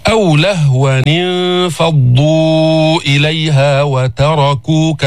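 slow chanted Quran recitation. Acted. Teacher recites the verse for the class, chanted slowly. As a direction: intone the verse slowly, tajwid style.

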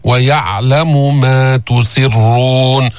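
"تسرون" slow, kasra sin then thick ra; heavy ra. Shown as a demonstration. Teacher models "تسرون" slowly for the class. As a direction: move from light sin to heavy ra. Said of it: "بعد أن تأتي بالسين مكسورة".

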